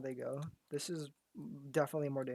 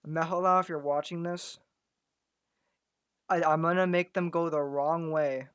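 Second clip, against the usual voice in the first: calling out; 3 semitones higher, 11 dB louder than usual. Real, not acted. Calling out to a viewer.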